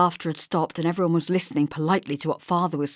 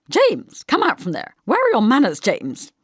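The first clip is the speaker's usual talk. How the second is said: put-on loud, high call to a child under the table; scolding; 7 semitones higher, 8 dB louder than usual. Mother scolds James hiding under the table, sharp and loud. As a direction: haul the boy out by voice.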